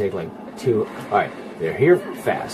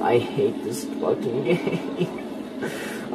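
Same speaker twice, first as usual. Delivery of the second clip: laughing; 6 dB quieter, much slower than usual. Real, not acted. Laughs while complaining.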